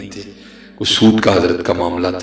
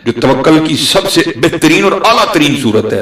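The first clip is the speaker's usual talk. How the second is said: higher and louder, fervent preaching; preaching at full force; 6 semitones higher, 6 dB louder than usual. Acted. Preacher to his congregation, full-force sermon voice. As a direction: lift the congregation with every name.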